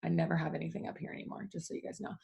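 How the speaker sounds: hushed aside. Real, not acted. Telling viewers she forgets everything, hushed aside. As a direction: drop to a near whisper for a quick self-mocking aside.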